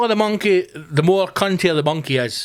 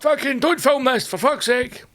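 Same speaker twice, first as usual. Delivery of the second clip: mock angry dad; 9 semitones higher than usual. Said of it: "the dad's like".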